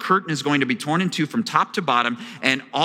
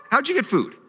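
puzzled surprise; 10 semitones higher than usual. Retold or imitated. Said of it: "And they're like".

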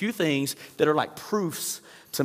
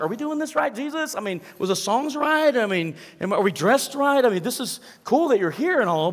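high, loud, flustered questions to Jesus; mock panic, tripping over the words; freaked-out churchgoer; 9 semitones higher, 4 dB louder than usual. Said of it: "we would freak out a little bit".

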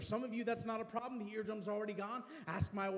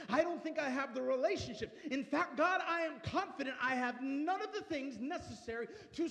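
loud, higher, impassioned plea; fervent preaching prayer; 3 semitones higher, 4 dB louder than usual. Acted. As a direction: preach the prayer at full force. Full-force prayer preaching.

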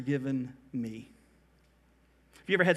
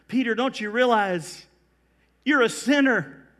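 raised, higher, insistent question; emphatic challenge; 5 semitones higher, 4 dB louder than usual. Acted. Jesus confronting Peter about his sin, emphatic challenge. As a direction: press the point home forcefully.

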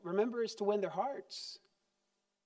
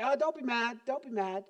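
dismissive; 4 semitones higher than usual. Retold or imitated. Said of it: "dismiss it and say".

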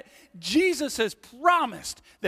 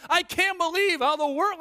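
high-pitched imitation of panicking; mildly panicked complaint; mock panic; 10 semitones higher than usual. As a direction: act out a worried overreaction. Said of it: "be panicking and saying".

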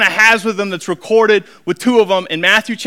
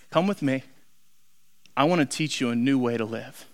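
soft, low, intimate call; hushed, low-voiced invitation; quiet invitation; 9 semitones lower, 11 dB quieter than usual. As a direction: offer it gently, pitched well down. Jesus's simple call, retold softly.

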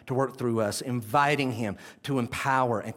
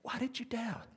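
Jesus gently asking; 8 semitones higher, 6 dB quieter than usual. Retold or imitated. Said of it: "he's wondering".

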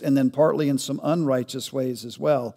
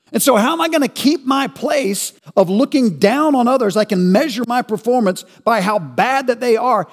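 higher, louder impassioned preaching; preacher pressing the congregation, fervent; preaching at full force; 8 semitones higher, 6 dB louder than usual. Acted. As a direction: drive the point home from the pulpit.

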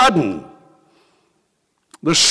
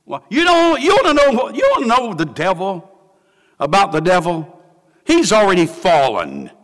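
usual speaking voice

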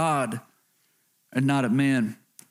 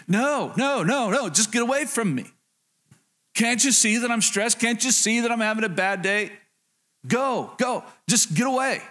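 mock-irritated, higher-pitched brush-off; bad-mood parent; 6 semitones higher than usual. Stressed dad to children after a bad day, mildly exasperated. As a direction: act out a stressed parent shooing the kids off. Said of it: "you're in a bad mood".

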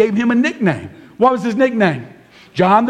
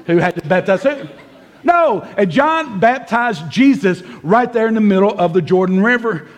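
ordinary talk

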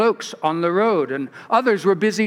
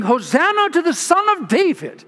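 mock crowd shout; 8 semitones higher than usual. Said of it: "they were shouting".

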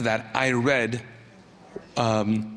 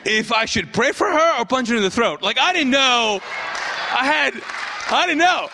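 stunned exclaim; 9 semitones higher than usual. Real, not acted. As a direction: share the shock, almost shouting. Pastor's stunned outburst at the punchline.

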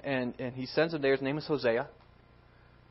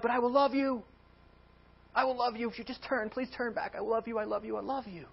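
desperate pleading; 9 semitones higher than usual. Acted. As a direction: beg her to come back, voice sinking. God pleading with bride to return.

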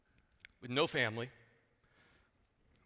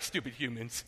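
God, amused; 7 semitones lower, 7 dB quieter than usual. Retold or imitated. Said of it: "God's like".

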